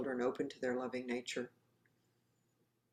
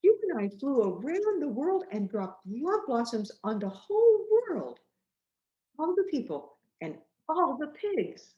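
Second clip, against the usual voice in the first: high, eager voice rushing through the dream; childlike excited retelling; 10 semitones higher, 8 dB louder than usual. Acted. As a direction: tell a wondrous dream, thrilled. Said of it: "full of excitement".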